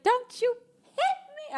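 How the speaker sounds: very high, slow, teary mock wail; mock crying plea. Imitating Kim pleading during the fight, high slow teary mock voice. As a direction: whimper it like a reality star mid-fight.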